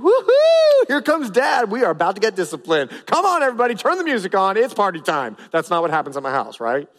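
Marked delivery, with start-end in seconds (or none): 0.0-5.4 hammed-up party glee, much higher and louder on woo-hoo; mock cheering kids. Preacher imitating his kids cheering at discipline, mock excitement. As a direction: play the kids cheering like dad brings a party. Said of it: "my kids aren't going".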